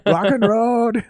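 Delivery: hammed-up accented rock shout of "Rock and Road"; mock accented yell. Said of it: "used to yell".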